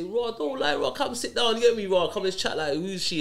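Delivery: high, eager dad calling his son over; a lightly voiced friendly father inviting him to sit and chat; coaxing dad. Dad coaxing his son to open up, high and eager.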